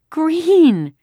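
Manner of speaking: high, loud wail of dismay; long, high childish cry of protest. A narrator's reading. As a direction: wail it like an appalled little girl. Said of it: "Kirsty wailed".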